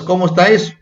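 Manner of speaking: ordinary talk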